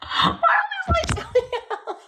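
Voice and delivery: very high, laughing, startled cry; high-pitched surprised laugh-cry; startled squeal. Real, not acted. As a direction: squeal it out as the lights die. Lights suddenly going out.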